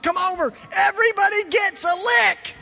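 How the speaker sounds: high, loud call to the crowd; excited yell. Retold or imitated. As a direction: shout the invitation to everyone. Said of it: "He yells at all of them".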